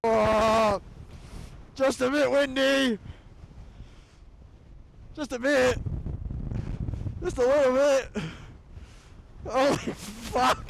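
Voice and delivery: high, slow awed exclamations; awed in the wind. Real, not acted. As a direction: gasp at the gale. Gale-force wind at the summit.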